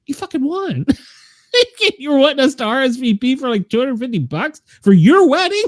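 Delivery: high, incredulous imitation of indignant guests; mock outrage. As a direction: pitch it way up in laughing disbelief. Voices outraged guests, laughing.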